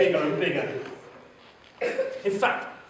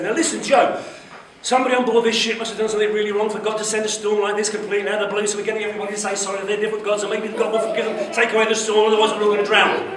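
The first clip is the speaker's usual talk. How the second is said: fast, loud, gabbled captain's speech; rushed speech; 3 semitones higher, 5 dB louder, much faster than usual. Acted. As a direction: rattle it off all in one breath. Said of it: "the captain said it a bit slower than that".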